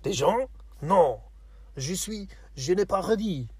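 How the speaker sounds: high, loud hammed-up French accent; exaggerated French. Imitating how French people talk, exaggerated French. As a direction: overdo the French, pitched well up. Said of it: "that's how French people talk".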